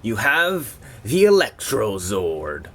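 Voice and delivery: slow, hammed-up unveiling; mock-epic toy reveal, slowed right down; dramatic reveal. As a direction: announce it like a grand reveal. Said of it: "I made that so dramatic".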